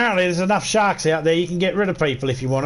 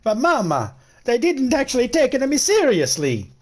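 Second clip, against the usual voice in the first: higher, complaining to mama; whiny mafioso son; 9 semitones higher than usual. Retold or imitated. Mafia son complaining to his mama back in Sicily, mildly whiny. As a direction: whinge like a boy back home to his mother. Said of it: "they said".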